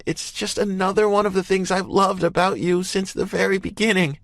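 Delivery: high, sincere, steady devotion; heartfelt woman's voice. A narrator's reading. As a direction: tell him he is perfect. Emerson's loving final blow.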